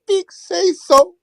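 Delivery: Big Say So stretched very high and slow; falsetto shout-out. Pretend. Calling out a viewer's name in a slow high falsetto wail.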